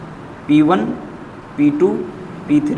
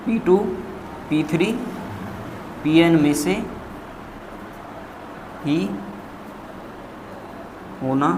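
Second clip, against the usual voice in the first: word by word, long pauses between; dictating while writing; 7 dB quieter than usual. Real, not acted. Teacher writing the line out for students, halting dictation.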